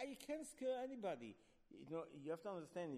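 ordinary talk

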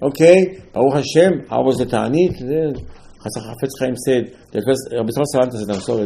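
ordinary delivery

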